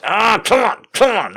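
high, strained grunted urging; mimicked exertion, high and squeezed; straining effort. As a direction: imitate someone heaving with each push. Imitates man straining while guiding.

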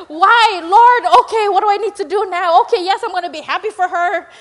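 loud, high mock wail to God; protest, then give in with exaggerated reluctance; pity party. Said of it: "ready to have my pity party".

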